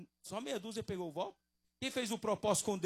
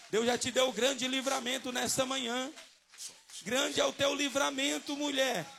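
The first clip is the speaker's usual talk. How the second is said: raised, high declaration; fervent preaching; 6 semitones higher, 7 dB louder than usual. Real, not acted. Preacher declaring deliverance to the woman, preaching loudly. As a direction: proclaim it loudly over the congregation.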